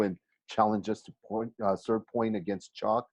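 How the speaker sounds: usual speaking voice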